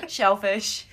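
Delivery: normal talking voice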